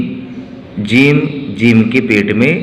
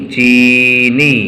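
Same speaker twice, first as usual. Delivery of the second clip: slow, drawn-out चीनी with each sound stretched; slow dictation; much slower than usual. Shown as a demonstration. Teacher dictating चीनी to children, slowly drawn out. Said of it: "हमको भी चीनी लिखना है".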